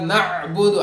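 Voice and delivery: نعبد with a throaty closed ain; deliberate نعبد, sukun on the ain; audible ain. Shown as a demonstration. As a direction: stop on the ain in "na‘-budu" for نعبد. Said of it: "عین کی آواز نکالنا ہے".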